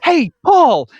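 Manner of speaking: big bright high greeting, shouted; loud sing-song hey over a mate's name; cheery shout. Retold or imitated. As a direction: call out Paul's name like an overeager yuppie. Retelling Leto's cheery greeting scene.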